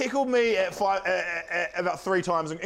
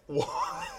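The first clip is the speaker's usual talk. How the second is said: squealing laugh; 7 dB quieter than usual. Real, not acted. Squealing laugh at own joke.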